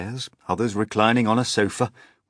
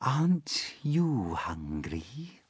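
soft, slow, higher spider question; 5 semitones higher, 6 dB quieter, much slower than usual. Acted. Female spider asking James, soft and slow. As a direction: coax it softly like a spider. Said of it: "she asked suddenly".

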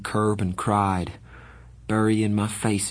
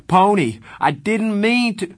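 higher, louder desperate scream; 12 semitones higher, 5 dB louder than usual. Acted. Darry screaming after fleeing Pony, desperate. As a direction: scream after him as he runs. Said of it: "Darry screamed".

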